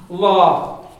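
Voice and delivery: "Law" with a long rounded vowel, loud; Connacht pronunciation. Shown as a demonstration. Teacher models the long A for class to repeat, Connacht pronunciation. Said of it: "the first one is Connacht".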